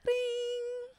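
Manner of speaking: high sung phone ring; mock phone ring. The husband calling, a high mock ring. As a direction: chime like a ringing phone.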